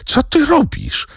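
sudden high cry of alarm on "ty"; frightened cry. A narrator's reading. Zachojski cries out as Kasia runs onto the rocks, frightened. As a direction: yelp it out, scared for her. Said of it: "krzyknął Zachojski, przestraszony".

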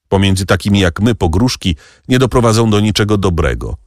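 usual speaking voice

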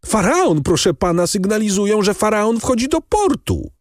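high, sustained excited pitch; excited announcement. A narrator's reading. Young man announces the ship's arrival, excitedly. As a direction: deliver astounding news in a high, urgent voice. Breathless good news, acted.

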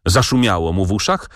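ordinary delivery

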